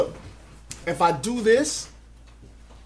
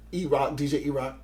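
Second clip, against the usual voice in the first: ordinary talk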